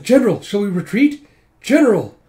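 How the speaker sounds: high, loud soldier's appeal; urgent calling. Retold or imitated. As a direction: call out to your general for orders. Retold soldier urgently calling the general.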